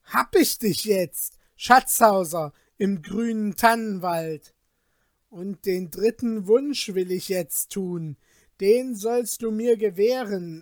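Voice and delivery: loud, greedy triumphant shout. Acted. Peter grabs the Glasmännlein by the collar, shouting greedily.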